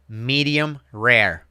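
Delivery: medium rare drawn out slowly, firm; slow insistent repeat. Retold or imitated. Waiter repeating his order to the guest, slow and firm. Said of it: "And he's like".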